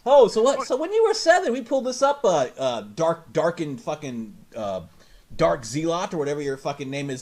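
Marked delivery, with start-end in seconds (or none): 0.0-2.2 high, loud mocking questioner; mock interviewer. Imagining someone confronting the grown-up kid. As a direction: needle him like a sarcastic host. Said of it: "he'll be like".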